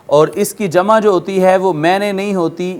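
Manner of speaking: ordinary talk, nothing marked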